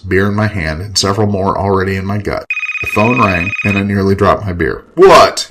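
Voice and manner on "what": loud, high, irritated snap; snapped. Acted. Drinker startled by the phone, snapping. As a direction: snap it into the receiver. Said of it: "I snapped into the phone".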